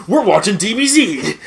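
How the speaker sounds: high, loud laughing shout; excited shout. Retold or imitated. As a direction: burst in yelling it. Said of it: "and was just like".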